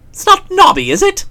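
high anxious query; alarmed question. Acted. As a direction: ask in sudden alarm.

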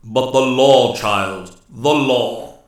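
loud, stern cry from a ruler; stern Master Woodsman. A narrator's reading. Master Woodsman reminds the nymph of the law, sternly. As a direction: lay down the law, forcefully. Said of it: "cried Ak sternly".

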